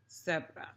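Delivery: zebra with a soft th, like "thebra"; Castilian lisped zebra. Shown as a demonstration. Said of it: "you would say zebra with this little cedilla sound".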